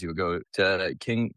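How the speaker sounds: usual speaking voice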